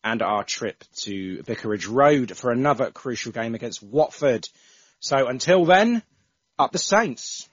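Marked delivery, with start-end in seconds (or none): 5.0-7.5 higher, louder, slow club cry; proud send-off to the fans; rallying sign-off. Acted. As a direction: call it out like a terrace chant. Podcast sign-off club chant.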